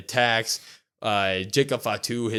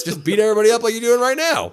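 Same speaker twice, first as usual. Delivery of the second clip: sarcastic suggestion; 10 semitones higher, 6 dB louder, much faster than usual. Real, not acted. Sarcastic advice to Roman.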